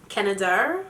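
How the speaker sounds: Canada said in a put-on British accent. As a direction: try "Canada" like a Brit. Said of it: "read it in my British accent".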